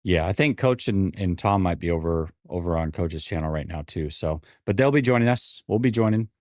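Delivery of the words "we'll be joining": ordinary talk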